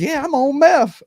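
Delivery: high, offhand imitation of the guy fixing the bike; calm dude. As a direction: play him as totally casual about it, up high. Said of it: "he goes".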